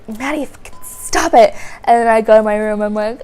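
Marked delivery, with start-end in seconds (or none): 0.1-1.5 a mock angry mom, pitched up and sharp. Mom telling Maddie to stop, mock angry yell. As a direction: snap it like a fed-up mother. Mom snapping at annoying daughter.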